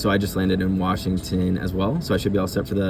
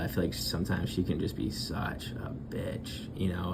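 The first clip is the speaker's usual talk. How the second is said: whispering; 8 dB quieter than usual. Acted. Badmouths colleague on call.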